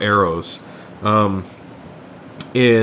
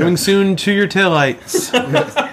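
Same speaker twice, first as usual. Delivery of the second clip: slow, raised mock-promo voice; parody trailer announcer; 7 semitones higher, slower than usual. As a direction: announce it slowly like a spoof coming attraction.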